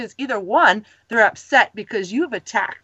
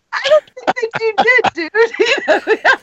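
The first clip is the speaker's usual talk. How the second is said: much higher, fast, laughing mock retort; teasing retort; 11 semitones higher than usual. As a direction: tease him with a laughing, pitched-up jab. [name] teasing the angry student.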